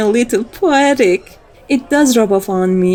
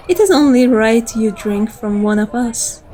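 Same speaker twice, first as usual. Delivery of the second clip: ordinary delivery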